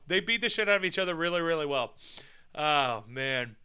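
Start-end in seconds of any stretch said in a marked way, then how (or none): none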